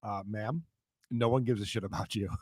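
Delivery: low, soft mock-cop reply; deadpan officer. Officer answering a ranting arrestee, deadpan. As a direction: let her down quietly, low and unimpressed.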